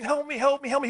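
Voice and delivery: high, frightened cry for help; a man on the floor pleading in terror, retold; imitating a desperate plea; retold scared scream. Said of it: "the gentleman on the floor screaming".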